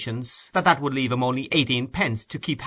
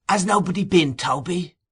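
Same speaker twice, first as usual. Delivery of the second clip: put-on higher, louder old man's query; 8 semitones higher, 4 dB louder than usual. A narrator's reading. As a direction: ask with keen suspicion.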